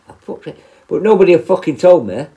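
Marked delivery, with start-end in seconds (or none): 0.1-0.6 muttered whisper; whispered exasperated curse; muttered under breath. Retold or imitated. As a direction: say it to yourself, barely voiced. Retold exasperation at himself.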